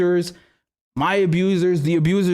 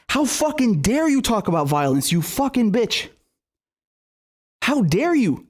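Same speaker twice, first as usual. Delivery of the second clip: real outrage, pitched higher as it builds; indignant anger; 5 semitones higher than usual. Real, not acted. Berating a congresswoman for talking about violence, indignant. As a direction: berate them, voice climbing in indignation.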